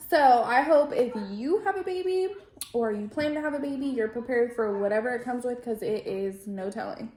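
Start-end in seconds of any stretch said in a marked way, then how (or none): none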